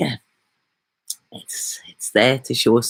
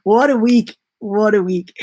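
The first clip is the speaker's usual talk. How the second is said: getting emotional; 8 semitones higher than usual. Real, not acted. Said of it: "I'm getting emotional".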